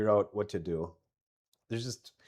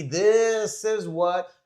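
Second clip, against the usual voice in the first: excited; 8 semitones higher, 8 dB louder, much slower than usual. Real, not acted. Building up to the song.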